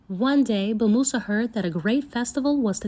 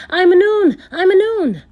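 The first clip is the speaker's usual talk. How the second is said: high, much louder triumphant shout; 9 semitones higher, 10 dB louder than usual. Acted. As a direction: shake the hat and shout at the monkeys.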